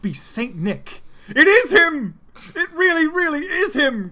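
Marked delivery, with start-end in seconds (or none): 1.3-4.1 much higher and louder, squealing with joy; overjoyed cry. Acted. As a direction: burst out like a delighted child. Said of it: "Cream cried, happier than ever".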